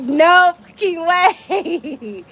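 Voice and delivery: high, slow, laughing no way; stunned disbelief. Retold or imitated. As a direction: drag it out in laughing shock. Disbelief at matching numbers.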